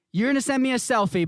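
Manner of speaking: normal talking voice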